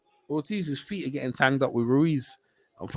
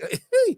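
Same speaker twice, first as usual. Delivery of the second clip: high laugh. Real, not acted. Laughing at cagey fighter.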